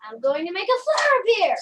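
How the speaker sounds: projected stage-magic proclamation; magician's command. Pretend. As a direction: announce the trick forcefully to the wand. Magician commanding a failing trick.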